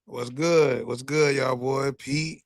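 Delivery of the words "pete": ordinary delivery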